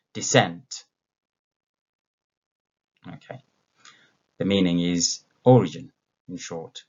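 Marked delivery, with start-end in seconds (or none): 0.1-0.8 "Descent" as a loud model, second syllable punched; stress on second syllable. Shown as a demonstration. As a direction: drill "Descent" with an unstressed first syllable. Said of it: "after me".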